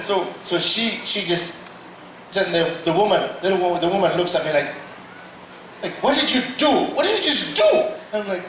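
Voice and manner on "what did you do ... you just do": high, alarmed demand; a high, startled question; alarmed mother. Retold or imitated. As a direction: accuse him in shock. Mother confronts the preacher.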